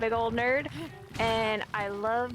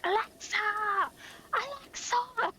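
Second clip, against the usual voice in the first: imitated little-girl scream; 11 semitones higher than usual. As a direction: mimic a small child screaming, much higher. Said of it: "screaming at an Alexa".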